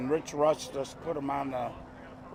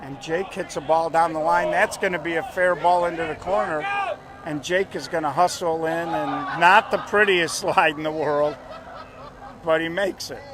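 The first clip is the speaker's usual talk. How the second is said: play-by-play; 4 dB louder than usual. Acted. Live play-by-play call.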